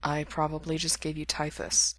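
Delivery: put-on sick young man muttering a joke; muttered. A narrator's reading. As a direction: mutter it under your breath. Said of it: "Mikey muttered".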